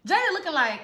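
normal talking voice

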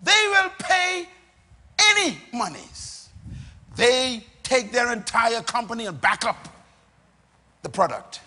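loud, high, emphatic pulpit proclamation; full-force preaching. Real, not acted. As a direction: hammer each phrase home to the congregation.

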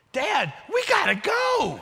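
put-on loud, high, drawn-out little-girl shout; imitated urgent child. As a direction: suddenly holler at Dad like an impatient kid. Child suddenly urges dad, retold.

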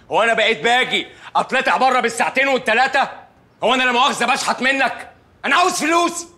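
high, indignant complaint climbing in pitch. Acted. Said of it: "وطي صوتك واحترم نفسك".

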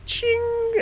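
very high metallic ching; lighter ching. Acted. Mimicking a gaudy Zippo flicking open, very high ching. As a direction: flick the lid open with flair.

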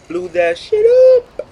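squealed high, shouted up top; excited yell. Real, not acted. Gamer bragging about the car kill, yelling. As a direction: burst out with the brag.

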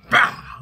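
high, loud "Bow!" for the headbutt; shouted impact sound. Shown as a demonstration. Showing viewers the headbutt, loud high "Bow!". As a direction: blast the hit noise out.

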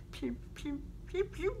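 softly voiced laser noises, last pew rising; playful pew pew gun sounds; mock laser pew. Playing with a toy, making laser sounds.

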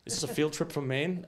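ordinary talk, nothing marked

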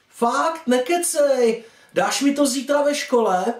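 high, eager schoolgirl voice; excited young girl. Acted. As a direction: jump up high in girlish delight. Young girl excited about a present. (vysoký nadšený dívčí hlas)

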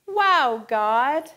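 loud "Wow, God!"; excited exclaim. Shown as a demonstration. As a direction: shout it with open hands. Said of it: "open your hands and say".

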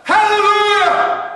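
huge high drawn-out shout of Hallelujah!; loud shout. Shown as a demonstration. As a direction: let Hallelujah! ring out as loud as you can. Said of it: "we're gonna hear a very loud shout".

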